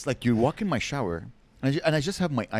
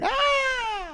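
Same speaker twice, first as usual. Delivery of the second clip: high startled yelp; mock scream. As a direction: imitate a shocked shriek. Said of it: "He's like".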